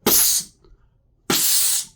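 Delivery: unvoiced secret psst; hissed psst. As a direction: sneak a whisper to get his attention. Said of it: "trying to be incognito".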